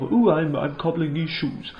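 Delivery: lightly mock upper-class English; posh English accent. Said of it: "English, Etonian, sort of".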